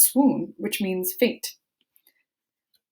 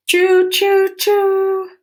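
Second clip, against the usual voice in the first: high, loud choo choo; train whistle; 13 semitones higher, 7 dB louder, much slower than usual. Shown as a demonstration. Teacher imitating a train whistle for children. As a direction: call it out like a steam train.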